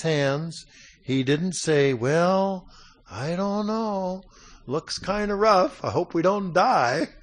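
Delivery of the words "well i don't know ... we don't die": higher, drawn-out uncertain voice; mock hesitancy. What he didn't say to the threat, mock hesitancy. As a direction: imitate someone dithering, slow and pitched up. Acts out the timid reply rejected.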